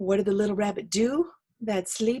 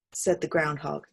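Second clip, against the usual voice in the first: usual speaking voice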